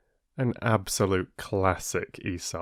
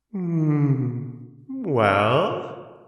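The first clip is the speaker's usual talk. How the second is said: high, pleased Sun voice, smiling; smug smiling Sun; 7 semitones higher, 4 dB louder than usual. Acted. Sun gloating over Wind, smiling. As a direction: purr it with a satisfied smile. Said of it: "said the sun, smiling".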